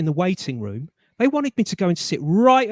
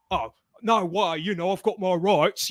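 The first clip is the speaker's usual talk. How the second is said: mock indignant. Shown as a demonstration. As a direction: play the stroppy customer demanding his rights. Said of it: "I didn't go".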